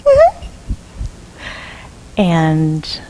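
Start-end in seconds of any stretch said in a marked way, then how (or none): 0.1-0.4 very high squeaky mm-hmm; squeak. Acted. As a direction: squeak it out like a tiny pig. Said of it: "Piglet squeaks".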